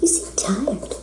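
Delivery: soft whispered words, barely voiced. Shown as a demonstration. Whispering the quote to herself while signing the print. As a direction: breathe it out as you write.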